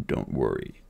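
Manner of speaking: a strong man's last weak words; comforting the boy while dying; dying bodyguard. Acted.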